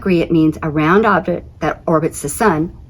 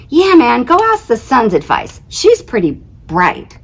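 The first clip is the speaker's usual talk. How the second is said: higher, louder dude voice; laid-back buddy; 6 semitones higher, 4 dB louder than usual. Acted. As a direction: egg your friend on. Planet character voiced in story.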